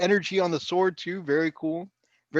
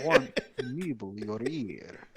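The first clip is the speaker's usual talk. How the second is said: put-on Transylvanian accent; 5 semitones lower, slower than usual. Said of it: "I just use the Transylvanian accent".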